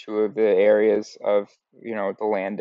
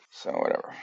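dismissive aside; 8 dB quieter than usual. Real, not acted.

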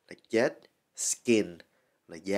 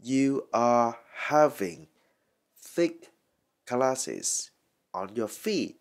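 "You are having thick glasses" slowly, in separated pieces; slow dictation of "thick glasses" sentence; word by word; much slower than usual. Shown as a demonstration. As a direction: pause between each chunk of "thick glasses on your feet". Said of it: "Rồi, mình đọc lại là".

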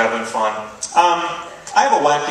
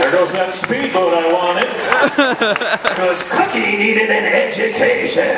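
grumbling dad; 7 semitones higher, 4 dB louder, slower than usual. Retold or imitated. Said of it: "Dad's reason why they're here".

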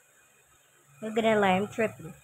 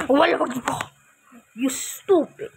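startled gasp; 3 semitones higher than usual. Real, not acted. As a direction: jump at the fright and blurt it. Startled by something in game.

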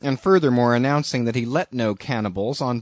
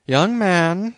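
higher, mildly stern address; 7 semitones higher, 4 dB louder than usual. A narrator's reading. Captain rebuking a joking young sailor, mildly stern. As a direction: call him to account with some severity. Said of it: "said Bildad sternly".

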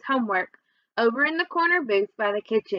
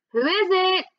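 higher call from another room; 8 semitones higher, 3 dB louder than usual. A narrator's reading. Carmela calling from inside to the door, higher. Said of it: "Carmela called from inside".

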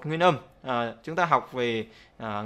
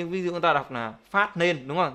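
usual speaking voice

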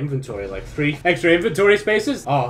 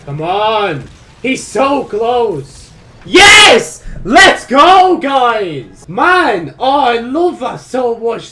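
much higher, much louder victory shouting; ecstatic cheering; 8 semitones higher, 12 dB louder, much slower than usual. Real, not acted. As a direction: roar the win with everything. Boss nearly dead, then killed.

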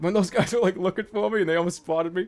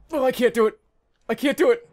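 higher, louder, frantic nerves; real panic; 8 semitones higher, 5 dB louder, faster than usual. Real, not acted. Gamer dreading the sniper shot, panicking. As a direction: psych yourself up in a panic. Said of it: "I can't pull the trigger".

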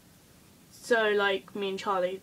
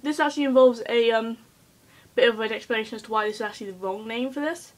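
ordinary delivery

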